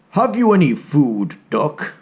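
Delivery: a hungry cat sizing up prey; lightly voiced greedy cat; menacing cat. Cat character speaks.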